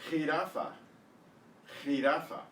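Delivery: "Jirafa" as khee-RAH-fah; exaggerated Spanish pronunciation. Shown as a demonstration. Teacher modelling a word to guess, "Jirafa" as khee-RAH-fah. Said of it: "trying to exaggerate a little bit".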